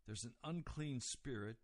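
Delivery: usual speaking voice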